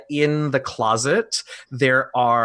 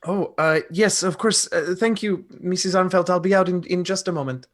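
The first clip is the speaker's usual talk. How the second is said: lightly voiced courteous young lodger, higher pitch; polite, flustered lodger; 7 semitones higher than usual. Lodger thanking his landlady for the call, a touch flustered. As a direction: answer politely, caught slightly off guard. Player speaks as the sleepy lodger.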